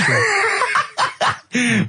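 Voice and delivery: a sudden high shrieking burst of laughter. Real, not acted. Host cracks up at Carl's story, shrieking laugh.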